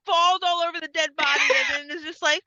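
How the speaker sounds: normal talking voice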